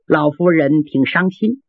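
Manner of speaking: ordinary delivery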